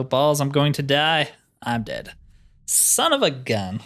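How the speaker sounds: ordinary talk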